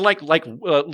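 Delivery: ordinary talk, nothing marked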